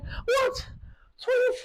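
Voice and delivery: high, soft gasping disbelief; shocked disbelief. Retold or imitated. Reacting to the doctor's twins news, high soft shock. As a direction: squeak out the shock.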